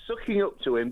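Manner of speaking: usual speaking voice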